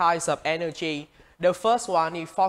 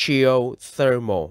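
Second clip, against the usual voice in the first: "geothermal" loud and slow, THER stressed; 5 dB louder, slower than usual. Shown as a demonstration. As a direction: model "geothermal" firmly and slowly, weight on THER. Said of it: "Các bạn nhấn vào vần ther".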